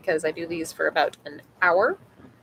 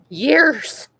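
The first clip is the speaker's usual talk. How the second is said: excited; 6 semitones higher, 7 dB louder than usual. Real, not acted. Playful repetition, high and loud.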